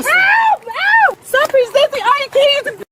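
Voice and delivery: high desperate screams; screaming in panic. Real, not acted. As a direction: scream it at full force. Woman dragged from car screaming.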